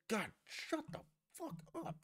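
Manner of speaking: strained, high-pitched exasperation; squeaky mock outburst of annoyance; exasperated. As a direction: cut her off, fed up. Exasperated reply to the mocked cook.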